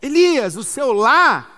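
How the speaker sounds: loud, high, commanding preacher's voice for the Almighty; Deus. Acted. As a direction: proclaim it slowly, punching and stretching key words. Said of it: "Essa pergunta de Deus pra Elias".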